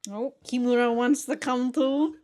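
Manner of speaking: usual speaking voice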